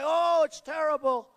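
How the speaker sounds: mocking critics. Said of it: "they'll say".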